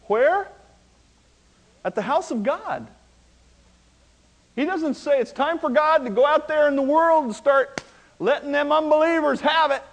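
preacher rising to a loud climax; full-force preaching. Acted. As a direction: thunder it to the congregation.

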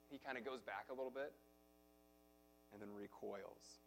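normal talking voice

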